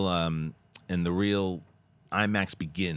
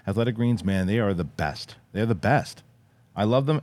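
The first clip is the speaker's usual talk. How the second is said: punchy, louder sponsor pitch; podcast ad read; 5 dB louder than usual. Real, not acted. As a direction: sell it enthusiastically to loyal listeners. Reads a sponsor ad.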